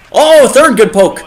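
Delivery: high, loud burst of excitement; thrilled gamer calling out; excited cheer. Real, not acted. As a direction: cheer the play as it happens. Cheering a third poke check.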